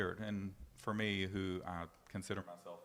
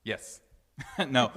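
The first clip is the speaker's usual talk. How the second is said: ordinary delivery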